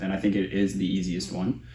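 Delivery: ordinary talk, nothing marked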